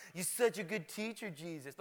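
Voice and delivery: mildly mocking fake compliment; sarcastic. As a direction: imitate religious critics sneering at a teacher. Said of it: "using it sarcastically, like".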